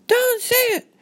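high, loud panicked squeal; urgent high-pitched girl's outcry; alarmed scolding. Acted. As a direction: cut them off in a child's shrill alarm. Girl scolds parent for saying word.

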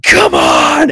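big roaring come-on shout; shouted challenge. Retold or imitated. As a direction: bellow it like a brawler squaring up. Batman challenges the knife thug.

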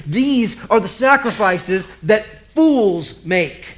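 ordinary talk, nothing marked

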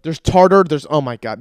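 loud, accusing mock dentist; upset, grossed out. Dentist finding tartar in the patient's mouth, upset. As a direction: accuse in disgust, breaking off appalled. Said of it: "they would get upset with me and gross".

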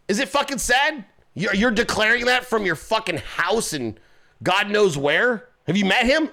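usual speaking voice

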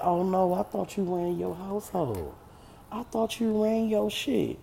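high-pitched teasing disbelief; mocking challenge. Friend needling the man about running his household, mocking. Challenging him in retold exchange.

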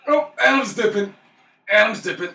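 high, loud repeated announcement; excited calling out. Real, not acted. Player fleeing the alert, high loud calling out. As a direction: holler it as you flee.

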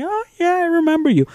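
very high, put-on recognition; mock sweet teacher. Teachers who probably won't remember you, mock sweet and high. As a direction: parody a teacher faking fond recall.

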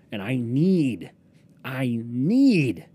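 NEED stretched long, swooping up and loud; emphatic craving. Real, not acted. Podcaster craving his favourite fries, "need" loud and drawn out. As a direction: draw out "need" with a big rise and fall.

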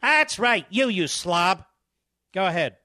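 angry jab. Real, not acted. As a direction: spit the insult at him. Insulting the guest on air.

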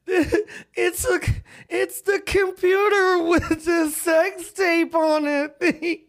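high, slow wailing cry; hammed-up sob that bursts into a yell; mock crying shout. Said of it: "She's like".

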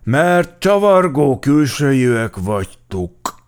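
slow, loud, high officer's pronouncement; drawn-out, overbearing condescension; haughty. A narrator's reading. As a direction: spell it out with lofty superiority. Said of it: "mondta fölényesen Gepárd Géza alőrmester".

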